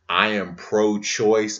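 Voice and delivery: slow, loud, firm statement; emphatic and deliberate. Real, not acted. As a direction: plant each word firmly. Emphatic declaration.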